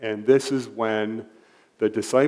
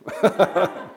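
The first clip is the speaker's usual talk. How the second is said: short laugh; 9 semitones higher than usual. Real, not acted. Laughs after own joke.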